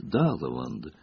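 soft, low, gracious reply; serene smile. A narrator's reading. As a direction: answer gently with a smile in the voice. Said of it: "Профессор Трелани светло улыбнулся". (тихо, ласково, с улыбкой)

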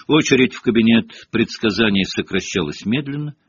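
usual speaking voice

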